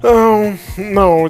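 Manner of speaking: normal talking voice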